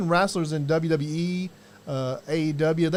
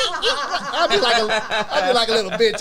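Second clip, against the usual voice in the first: laughing; 5 semitones higher than usual. Real, not acted. Laughing at own squeamishness.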